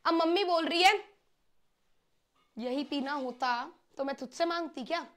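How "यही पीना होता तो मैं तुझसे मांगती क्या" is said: mildly sarcastic mother, softly put; sarcastic taunt. Acted. Mom to child who brought a sip of water, sarcastic. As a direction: make a dry, understated dig. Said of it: "taunt मार रही है mummy".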